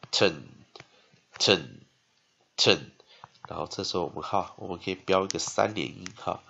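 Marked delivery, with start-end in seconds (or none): 0.1-2.9 "ten" said as a reduced "tn" with a schwa, falling; weak-vowel drill. Shown as a demonstration. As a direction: repeat "ten" singly with long pauses, weak vowel. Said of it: "A念呃".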